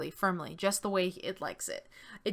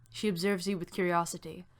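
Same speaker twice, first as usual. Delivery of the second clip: usual speaking voice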